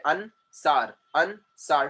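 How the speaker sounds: "Ansar" as An, pause, sar; two detached beats on "Ansar"; split into syllables. Shown as a demonstration. Teacher using own name as example, "Ansar" split in two. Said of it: "an is a syllable".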